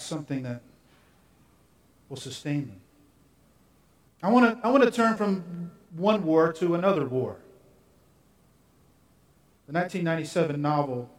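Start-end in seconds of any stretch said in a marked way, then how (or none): none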